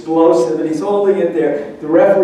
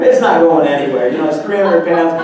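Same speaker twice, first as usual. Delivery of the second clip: ordinary talk, nothing marked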